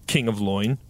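ordinary talk, nothing marked

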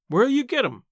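high, challenging question; demanding. A narrator's reading. Aunt challenges bridegroom about a new minister, demanding. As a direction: demand an answer, mildly put out. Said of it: "demanded Aunt Philippa".

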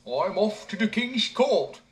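proudly announcing his journey; simpleton. A narrator's reading. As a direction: simpleton answering the old man. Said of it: "he answered".

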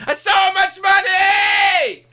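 mock bragging player. Mocking a player on a football podcast, hammed-up shouting. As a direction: shout like a dim, rich footballer.

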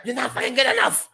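fast, high-pitched angry outburst; going ballistic. Retold or imitated. Retelling coach's blowup at a player, fast and ballistic. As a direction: fire it off like a coach blowing up. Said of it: "just goes ballistic".

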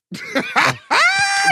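very high shriek of laughter; squealing laugh. Real, not acted. Guest laughing while bantering with friend, squealing laugh. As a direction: let out a piercing, squealed laugh.

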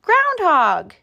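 high, loud wake-up call; excited high-pitched hail. A narrator's reading. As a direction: call out to rouse a sleeper. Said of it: "he called".

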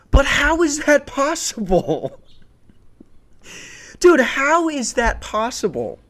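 very high, drawn-out bafflement; incredulous. Real, not acted. Comic to listeners about flight attendants, high-pitched incredulity. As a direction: squeal the question in comic disbelief, stretching it.

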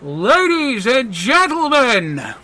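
drawn-out, soaring ringmaster cry; big magician's entrance call; showman announcement. Acted. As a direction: belt out a grand stage entrance, high and slow. Game master announces a magician's grand entrance.

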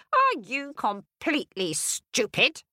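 high, scolding stepmother voice; wicked stepmother. Acted. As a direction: scold her like a cruel stepmother. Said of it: "shrieked the stepmother".

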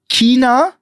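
China begun with a hard K, "Kina", rising. Shown as a demonstration. Podcaster offering the other correct variant, China with K. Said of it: "richtig wäre auch noch China".

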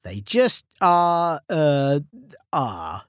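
drawn out and trailing; slow mysterious. Acted. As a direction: stretch it out, trailing off. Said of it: "Are what?".

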